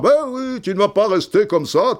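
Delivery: very high, clumsy mock Belgian accent; hammed-up Belgian coach, badly faked accent; Goethals, badly imitated. Coach insisting he join them at table, bad mock Belgian accent. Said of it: "ah, je le fais très mal".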